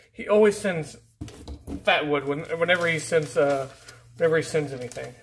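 normal talking voice

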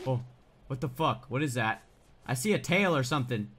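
ordinary talk, nothing marked

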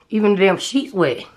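ordinary talk

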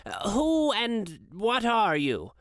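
lightly voiced old Scrooge, slow and uneasy; a slow, wary old miser. A narrator's reading.

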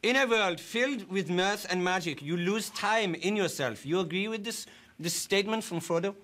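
put-on broken English with a German accent. A German-accented interviewer greets his guest politely. Fake foreign interviewer throughout.

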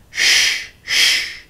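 long unvoiced shushes; hushing. A narrator's reading. Sister stops brother being overheard, hushing. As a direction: shush urgently, twice.

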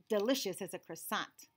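plain reading voice

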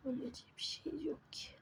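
whispered exasperated aside; soft whispered complaint. Real, not acted. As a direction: hiss it quietly to yourself. Muttering complaint while playing.

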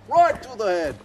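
put-on high-pitched playful Australian accent. Archer repeating his boast after a good hit, Australian accent. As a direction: crow it high like an excited Australian. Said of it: "have an Australian accent".

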